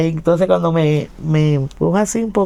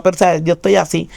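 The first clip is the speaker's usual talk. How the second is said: ordinary talk, nothing marked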